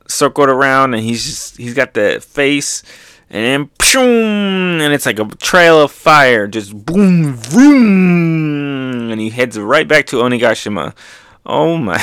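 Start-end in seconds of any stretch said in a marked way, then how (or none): 3.8-4.8 high, drawn-out "pshoom"; imitated blast-off; whooshing launch noise. Imitates launch trailing fire. 6.5-9.1 punchy boom into long vroom; loud, higher engine roar; imitated zoom away; rocketing-off noise.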